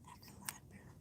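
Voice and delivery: very faint, breath-only whisper; fainter library whisper. Real, not acted. Vlogger repeating where she is, in the faintest whisper. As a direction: let it sink to almost nothing.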